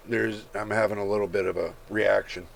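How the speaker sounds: ordinary talk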